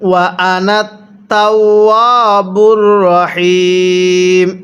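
ওয়া আনা short, তাওয়াবুর রহিম slowly chanted; slow recitation. Shown as a demonstration. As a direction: recite without stretching আনা. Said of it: "হামজায়ের মধ্যে টান দিব না".

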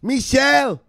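high, slow, loud calling mama voice; mother calling. Pretend. Mother calling her son down from the basement, high and slow.

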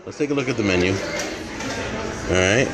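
ordinary delivery

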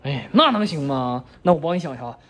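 ordinary delivery

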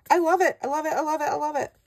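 giddy high-pitched repeats; freaking out. Real, not acted. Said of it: "I'm freaking out".

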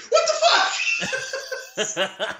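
drawn-out, high mock outburst; imitated snap. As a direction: stretch out an incredulous, high-pitched blow-up. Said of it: "he just fucking snaps".

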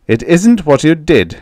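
loud, stern accusation; said loudly. A narrator's reading. Riddell confronting the farmers, loudly. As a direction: speak up so the whole bar hears. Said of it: "Riddell said loudly".

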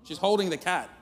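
ordinary talk

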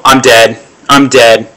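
usual speaking voice

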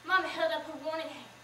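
usual speaking voice

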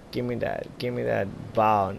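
ordinary talk, nothing marked

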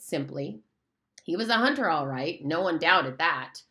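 plain reading voice

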